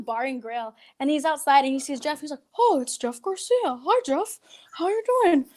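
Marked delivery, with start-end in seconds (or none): 2.6-5.4 high-pitched put-on greeting; raised voice, hammed-up hello; Fraser Smith. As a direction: impersonate a chummy radio personality. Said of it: "I could do Fraser Smith".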